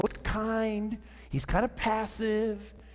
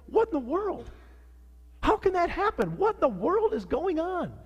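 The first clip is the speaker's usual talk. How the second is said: higher-pitched, appalled questioning; incredulous disbelief; 8 semitones higher than usual. Retold or imitated. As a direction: relive the shock, pitch leaping up. Retelling shocked reaction to news.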